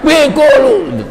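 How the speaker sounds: angry person yelling up close. Retold or imitated. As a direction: yell it in anger. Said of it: "orang marah pasti teriak".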